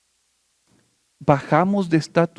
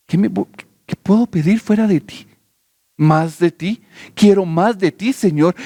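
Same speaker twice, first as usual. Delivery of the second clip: raised, impassioned worship prayer; oración ferviente de adoración; fervent prayer; 5 semitones higher than usual. Acted. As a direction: pour it out to God, pleading and adoring. Pastor turns to fervent prayer.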